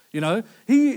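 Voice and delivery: ordinary talk, nothing marked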